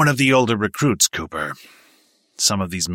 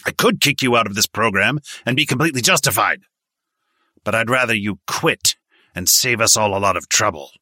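higher, louder, angry lecture; stern berating; 5 semitones higher, 6 dB louder than usual. A narrator's reading. Instructor scolding recruits for half-hearted training, angry lecture. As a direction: chew them out like a hard sergeant.

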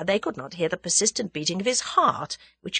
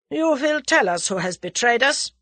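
put-on higher-pitched German-accented threat; 7 semitones higher, 4 dB louder than usual. A narrator's reading. German demands the traitor's name, German accent. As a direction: interrogate as a German. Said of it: "said the German".

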